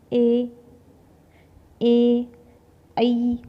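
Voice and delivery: loud, drawn-out ए ऐ ओ औ; teacher sounding vowels one by one; vowel demo. Shown as a demonstration. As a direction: voice each vowel loud for the class. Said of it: "तो ये भी हमारे आगे वाले स्वर".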